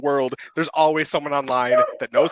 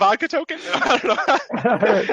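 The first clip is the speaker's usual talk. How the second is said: laughing joke; 8 semitones higher, slower than usual. Real, not acted. Joking reply, laughing.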